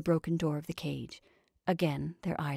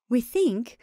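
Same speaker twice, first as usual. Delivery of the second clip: raised, louder, flustered start; flurried; 5 semitones higher, 7 dB louder than usual. A narrator's reading. Sheriff's wife answers the attorney, flurried. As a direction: begin in a fluster, pitch lifted. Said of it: "began the sheriff's wife in a flurried voice".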